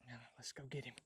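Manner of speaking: hushed whisper going after the bird. Real, not acted. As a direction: whisper it, staying unheard. Sneaking up to the bird.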